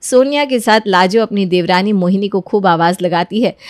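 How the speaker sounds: low, even storyteller voice; story narration. A narrator's reading. Narrator tells listeners how the family reacts, calm and low. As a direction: narrate the scene calmly.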